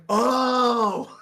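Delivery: loud, high drawn-out "oh"; sudden realization. Retold or imitated. Storyteller finally grasps his friend's confession, loud realization. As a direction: let the penny drop loudly.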